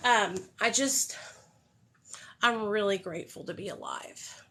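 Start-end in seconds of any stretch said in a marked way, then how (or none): 2.4-4.4 soft, choked gratitude near tears; getting emotional. Real, not acted. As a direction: fight back tears while thanking. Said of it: "I will probably get emotional".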